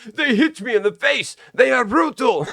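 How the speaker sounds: high, whining mock complaint; whiny victim voice. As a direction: play the wounded crybaby. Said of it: "they're like".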